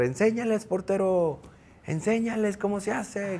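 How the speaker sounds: high-pitched mock shout from the stands; imitating a fan yelling. Fan in the stands cheering the goalkeeper on, high mock yell. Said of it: "un señor empieza a gritar".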